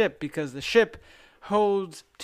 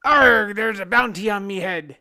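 higher pirate growl, Arg blasted loud; 3 semitones higher, 5 dB louder than usual. Retold or imitated. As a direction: ham up a cartoon pirate punchline. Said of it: "And the pirate goes".

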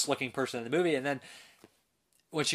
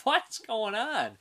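high, slow, drawn-out confusion; baffled; 11 semitones higher, much slower than usual. Retold or imitated. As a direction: act totally lost, stretch it out. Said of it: "You're just like".

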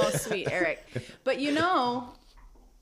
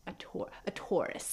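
menacing mobster hush; mobster gangster voice; 8 dB quieter than usual. Pretend. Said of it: "you guys are sounding like mobster gangsters".

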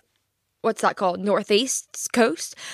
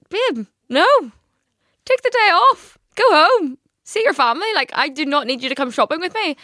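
much higher and louder, shooing him home; high insistent refusal; 8 semitones higher, 4 dB louder than usual. Retold or imitated. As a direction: tell him to take the day off, loud and high. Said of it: "And I was like".

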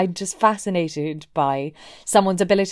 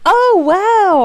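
high, loud mock amazement; hammed-up gasp of wonder in words; endlessly surprised; 11 semitones higher, 8 dB louder than usual. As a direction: imitate being astonished all over again. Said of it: "endlessly surprised by how this sari works".

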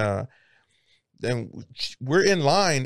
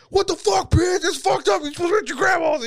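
high, fast imitation of a betrayed friend; mock outraged boyfriend. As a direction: squeal it out like a wronged guy. Voicing friend confronting girlfriend.

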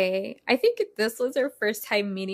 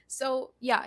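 normal talking voice

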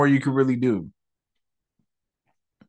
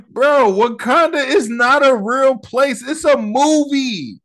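high-pitched, indignant protest; exasperated outburst; 9 semitones higher, 4 dB louder than usual. Real, not acted. Fan protesting that a fictional country isn't real, exasperated. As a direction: insist incredulously, voice pitched way up. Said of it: "I'm just mad 'cause".

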